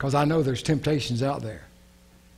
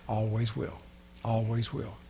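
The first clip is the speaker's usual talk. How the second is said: soft conviction; 4 semitones lower, 9 dB quieter than usual. Acted. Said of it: "repeated quote".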